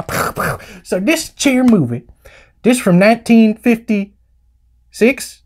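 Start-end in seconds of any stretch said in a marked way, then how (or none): 0.0-0.6 soft puffed mouth gunshots; imitated gunshots. Host capping his cowboy catchphrase, mock gunshots. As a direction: puff out two pretend pistol shots.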